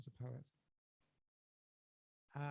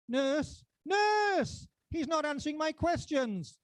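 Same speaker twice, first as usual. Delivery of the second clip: very high, loud call for help; panicked yell; 13 semitones higher, 14 dB louder, much slower than usual. Acted. Patient calling the nurse in a hospital, panicked yell.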